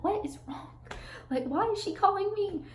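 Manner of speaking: retold bewildered question. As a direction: act out puzzled exasperation, voice lifted high. Retold puzzlement at repeated phone calls.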